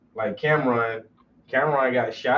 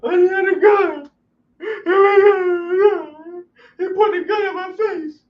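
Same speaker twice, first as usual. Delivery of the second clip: hysterical sister; 5 dB louder than usual. Retold or imitated. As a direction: play the crying sister gasping it out. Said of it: "stop all that damn crying".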